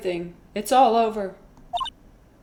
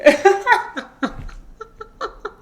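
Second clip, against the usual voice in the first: crying laugh; 13 semitones higher, 6 dB louder than usual. Real, not acted. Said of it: "Starts crying".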